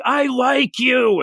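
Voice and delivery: high, drawn-out mock scream; hammed-up anime confession, stretched out high; over-the-top anime yell. Girl confessing her crush in a manga, mock over-the-top yell. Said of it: "she yells".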